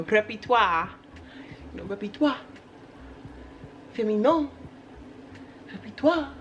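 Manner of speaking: mock French, last syllable lifted; French accent. Vlogger showing off her French-sounding words, playful French accent. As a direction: toss out each word in a put-on French accent. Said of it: "I think of French".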